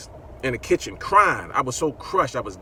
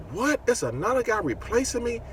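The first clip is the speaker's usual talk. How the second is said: shocked disbelief; 12 semitones higher than usual. Retold or imitated. Hears he was replaced.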